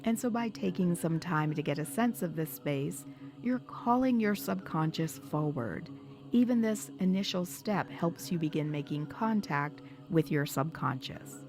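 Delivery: ordinary delivery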